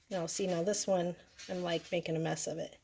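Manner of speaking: normal talking voice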